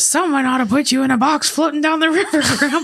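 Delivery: quick high laughing cartoon villain; mock Plankton voice through laughter; Plankton impression. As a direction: sneer it like a tiny cartoon villain, fast. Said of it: "it's Plankton on SpongeBob".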